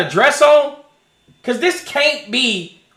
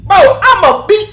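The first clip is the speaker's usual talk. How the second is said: heated threat; 5 semitones higher, 4 dB louder than usual. Retold or imitated. Angry rant at person recording.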